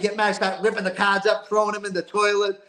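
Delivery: normal talking voice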